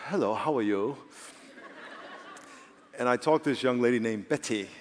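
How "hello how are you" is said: hammed-up English accent. Pastor showing the English accent he loves, mock English. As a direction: put on a polite English accent. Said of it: "I love the English accent".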